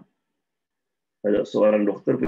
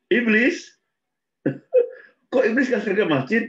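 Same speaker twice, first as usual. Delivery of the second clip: high, incredulous laughing question; can't believe what he just heard; shocked disbelief; 11 semitones higher than usual. Retold or imitated. Blind man stunned the devil guides him, surprised.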